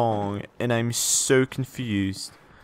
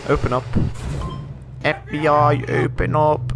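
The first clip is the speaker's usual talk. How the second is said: mock FBI agent. Jokingly banging on door.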